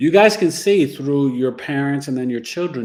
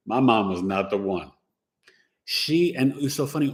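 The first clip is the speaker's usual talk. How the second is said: ordinary delivery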